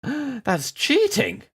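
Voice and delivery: gasp, then high, slow indignant cry; outraged gasp at unfairness; shocked outrage. Real, not acted. Enemy has a ballista too.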